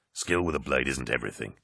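low, understated objection; demur, unwilling to be pushed; quiet reluctant protest. A narrator's reading. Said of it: "Loken protested".

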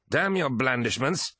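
high-pitched, contemptuous curse; spat angrily. A narrator's reading. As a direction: spit the words out in anger. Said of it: "spat Eidolon".

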